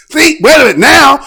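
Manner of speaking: ordinary talk, nothing marked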